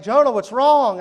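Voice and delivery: mock alarmed parents asking what's wrong. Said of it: "they're like".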